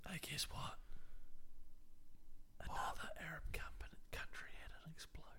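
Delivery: hushed, breathy mock secret; mock whisper. Host whispering a headline as if it were a secret. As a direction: whisper it like gossip.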